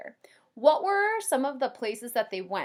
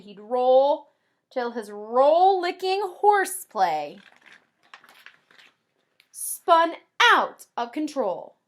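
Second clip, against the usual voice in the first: slow and louder storybook climax; lively read-aloud building up; 2 semitones higher, 7 dB louder, slower than usual. Acted. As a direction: swell the rhyme toward the chaos. Reading the book aloud.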